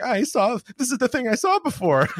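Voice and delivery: high-pitched excited squeal; squealing joy. Retold or imitated. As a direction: squeal like a thrilled fan. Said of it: "take some squealing joy from".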